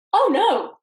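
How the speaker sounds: high, loud wail of dismay; dismayed cry. Acted. Told the peach is rotten inside, loud high dismay.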